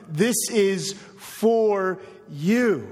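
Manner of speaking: very slow, with "you" weighted; drawn-out, personal appeal; slow emphasis. Acted. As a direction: let each word land on the listener. Preacher presses point home.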